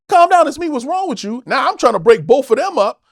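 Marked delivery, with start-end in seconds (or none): 0.1-1.4 high, loud pleading to calm down; retold plea. Friend pulling a brawler off, high loud pleading.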